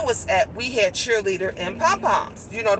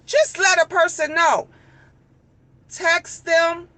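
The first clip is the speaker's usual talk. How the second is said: higher, pointed list; higher exasperated scolding; 7 semitones higher, slower than usual. Real, not acted. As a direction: spell it out, fed up. Venting about rude seller.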